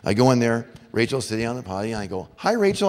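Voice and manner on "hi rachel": put-on high, loud hello to a little girl; breezy dad greeting. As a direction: call it out lightly as a chirpy dad. Dad greeting his small daughter.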